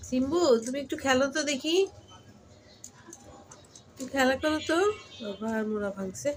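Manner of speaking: high, gentle urging; coaxing. Real, not acted. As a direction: coax the cat to play. Coaxing the sick cat to play. (অসুস্থ বিড়ালকে আদর করে খেলতে বলা)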